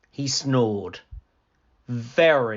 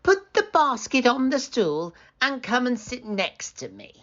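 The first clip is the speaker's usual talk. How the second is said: higher coaxing granny voice; wolf as grandmother; 12 semitones higher than usual. Acted. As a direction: lure her closer.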